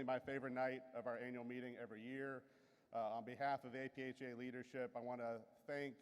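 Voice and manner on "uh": ordinary talk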